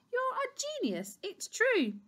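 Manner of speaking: high-pitched gushing keeper; delighted praise. Acted. Keeper praises Doctor Duck, delighted gushing. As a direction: gush admiringly, pitch leaping up.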